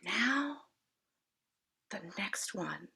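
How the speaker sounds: breathy hushed whisper. Acted. An whispering to himself, placing a block. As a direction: whisper it with total focus. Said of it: "An whispered".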